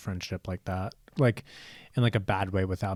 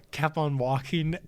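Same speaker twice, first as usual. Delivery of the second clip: usual speaking voice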